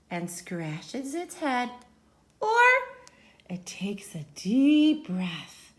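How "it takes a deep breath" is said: whispered deep breath. Acted.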